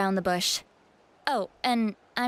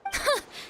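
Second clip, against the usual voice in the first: smug chuckle; 4 dB quieter than usual. Acted. Elder gloating about her trick.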